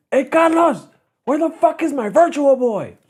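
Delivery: very high-pitched imitation of an angry call; hot-headed yell. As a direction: mimic a cousin yelling for someone, no real volume. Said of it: "He shouldn't have yelled".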